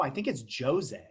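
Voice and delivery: "Jose" with an English J, "JOE-zay"; English J pronunciation. Real, not acted. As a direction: insist on "Jose" starting like Joe. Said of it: "is it Jose or Jose".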